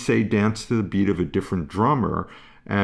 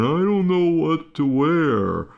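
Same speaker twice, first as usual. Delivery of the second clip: put-on dithering child; 6 semitones higher than usual. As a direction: play a kid wandering and at a loss. Voicing child lost at closet.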